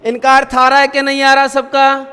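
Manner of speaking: ordinary delivery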